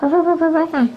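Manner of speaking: high-pitched repeated bird cry; imitated pheasant call. Monk mimicking a pheasant for the congregation, high bird cry. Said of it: "Gà lôi nó rót".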